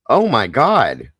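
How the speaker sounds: slow, loud, high shocked cry of disbelief; shocked gasp. Real, not acted. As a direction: blurt it out, stunned and horrified. Reacting to a horrifying story.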